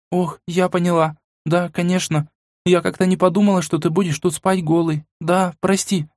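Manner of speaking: put-on high, embarrassed flustered girl. A narrator's reading. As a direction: blush and backpedal. Flustered Mila. (смущённая девушка)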